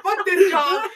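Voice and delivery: loud, high, laughing yell of defiance; gleeful shout. Retold or imitated. Quitter yelling at her old job, loud and laughing. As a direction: shout it out as you drive away.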